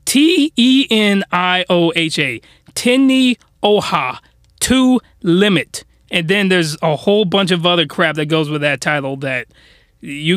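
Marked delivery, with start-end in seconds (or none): 0.0-2.4 slow, letter by letter; measured, separated letters; spelling it out. Shown as a demonstration. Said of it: "gonna spell it out for you". 2.7-5.8 slow, deliberate title for listeners to note; spelled-out title. Shown as a demonstration. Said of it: "gonna spell it out for you".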